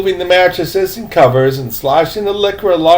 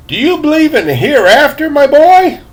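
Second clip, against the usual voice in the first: put-on old man voice; 10 semitones higher, 4 dB louder than usual. A narrator's reading.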